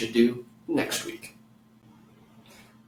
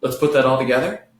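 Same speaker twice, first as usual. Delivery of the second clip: ordinary talk, nothing marked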